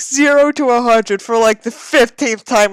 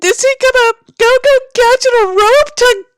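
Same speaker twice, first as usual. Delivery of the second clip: very high, loud, slow shrieking; frantic disbelief; 6 dB louder than usual. Real, not acted. Reader watching Davis run onto the freeway, frantic high shriek. Panicked reaction to fic.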